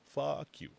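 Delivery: slow, mock dismissive jab; mock rude. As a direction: tell them off jokingly. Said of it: "I'm just kidding".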